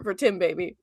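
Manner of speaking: ordinary talk, nothing marked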